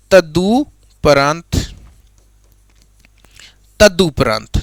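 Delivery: तदुपरांत drawn out slowly; slow dictation. Shown as a demonstration. Teacher to students, repeating the answer slowly. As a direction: spell out तदुपरांत syllable by syllable. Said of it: "शब्द क्या बन गया".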